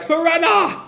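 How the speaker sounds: high acted cry after a comrade; calling back. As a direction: cry the name in mock desperation. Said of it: "[name] calls back".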